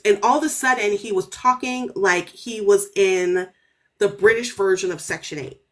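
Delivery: usual speaking voice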